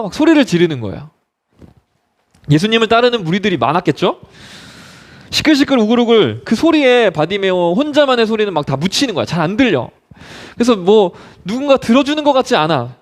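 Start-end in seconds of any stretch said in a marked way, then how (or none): none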